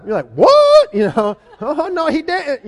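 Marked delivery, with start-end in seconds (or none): 0.3-0.9 yelped very high and loud; an outraged parent's disbelieving what; shocked parent. Retold or imitated. Parent stunned by child's defiance. 1.6-2.6 a touch of mock attitude, a bit higher; lightly hammed-up outrage at the kid; sassy indignation. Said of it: "you get all crazy".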